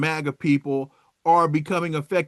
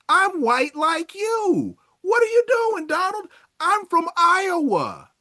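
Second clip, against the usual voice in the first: higher, pleading complaint that builds; mock betrayed MAGA voter; 3 semitones higher than usual. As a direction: whine at Donald like a cheated farmer.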